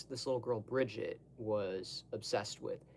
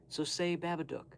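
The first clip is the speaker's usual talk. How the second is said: ordinary talk, nothing marked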